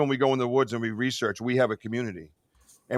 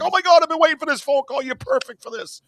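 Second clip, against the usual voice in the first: high, fast, thrilled squeal; excited squeal; 12 semitones higher, 6 dB louder than usual. Retold or imitated. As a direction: gush with delight, fast and high. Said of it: "And she's like".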